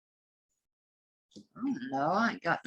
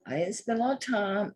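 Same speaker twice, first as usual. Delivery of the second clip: normal talking voice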